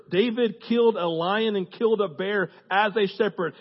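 ordinary delivery

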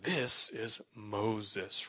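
low, weighty announcement of a great man; deep reverent Moses voice. Acted. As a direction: drop way down, slow and hushed. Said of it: "you say his voice".

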